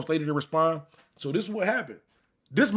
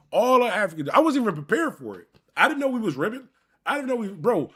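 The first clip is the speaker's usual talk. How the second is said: indignant; 5 semitones higher, 4 dB louder than usual. Real, not acted. Recalling being caught off guard.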